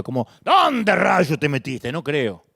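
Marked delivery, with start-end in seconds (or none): 0.4-1.9 loud, slowed, accusatory demand; the accusatory reading the preacher rejects, loud and slow; accusing God. Pretend. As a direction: bark it like an angry father. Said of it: "no creo que eso no acusatorio".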